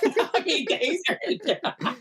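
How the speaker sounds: laughing through the words; cracking up mid-sentence. Real, not acted. Laughing while talking.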